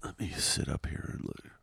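soft whispered aside; whispering. Real, not acted. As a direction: whisper it to yourself. Mutters aside while adjusting.

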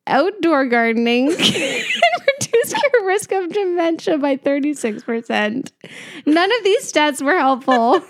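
fighting laughter; laughing while reading. Real, not acted. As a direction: push through the sentence while cracking up. Giggling through more useless stats.

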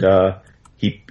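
normal talking voice

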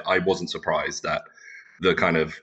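ordinary talk, nothing marked

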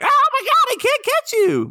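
very high, loud mock shriek; squealing child. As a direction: imitate a kid shrieking mid-chase. Child chasing dad, acted out.

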